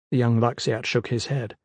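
ordinary delivery

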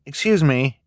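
put-on a boy's polite interruption. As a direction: cut in gently but firmly.

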